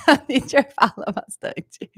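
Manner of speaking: laughing through the words. Real, not acted. Teacher laughing at her own chattiness. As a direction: giggle while admitting it.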